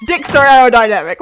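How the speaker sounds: loud, emphatic punchline; mock reassurance. Repeats the joke line more controlled.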